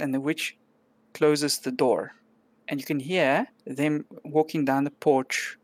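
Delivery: ordinary talk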